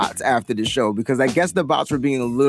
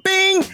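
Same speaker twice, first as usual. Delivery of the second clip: high, held "bing"; ding like a phone alert; imitated alert chime. Host mimicking an alert popping up, high chime.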